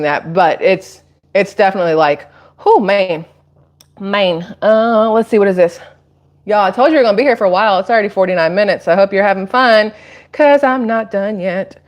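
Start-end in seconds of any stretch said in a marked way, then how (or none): none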